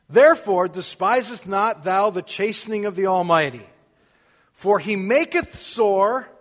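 loud, slow, solemn King James reading; scripture reading. Acted. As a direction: proclaim the verses with weight.

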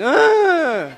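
high, loud strangled yelp; retold tickled scream. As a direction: squeal like a helpless kid being tickled. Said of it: "I'd just scream".

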